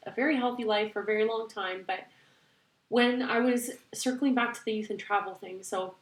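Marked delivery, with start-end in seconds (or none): none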